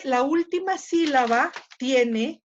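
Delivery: usual speaking voice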